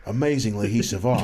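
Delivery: low, eerie nature-documentary narrator. Retold or imitated. Retelling a nature show's twist to friends, low eerie voice. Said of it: "this eerie voice like".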